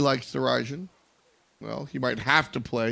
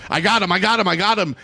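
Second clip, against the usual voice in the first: louder, quick-fire mock excitement; imitated eager player; 5 semitones higher, 6 dB louder than usual. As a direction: act out a player's overeager call. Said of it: "Nika just goes".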